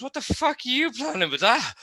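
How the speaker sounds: husband's disbelieving question pitched high; mock shocked husband, voice jumping high; imitating husband. Husband asking from across the table, mock shocked and high. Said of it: "he goes".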